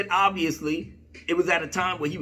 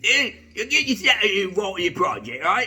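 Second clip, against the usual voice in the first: hammed-up British geezer accent; comic British impression, getting more worked up. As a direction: rant in a mock British accent. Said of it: "he's saying in his British accent".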